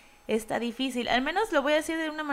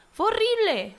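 "horrible" flung out much higher and louder; horrified exclaim; 9 semitones higher, 4 dB louder than usual. Real, not acted. Recalling how cruel 2006 was, horrified high exclaim. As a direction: hit "horrible" high and hard, appalled.

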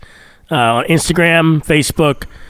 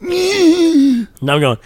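ordinary talk

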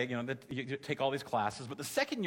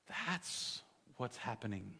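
low, very soft and slow; hushed realisation; 4 semitones lower, 11 dB quieter, slower than usual. Retold or imitated. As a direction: quietly voice a truth sinking in. Retells the moment of realisation.

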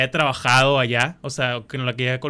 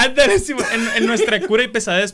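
laughing; 7 semitones higher, 3 dB louder than usual. Real, not acted. Laughing while telling.

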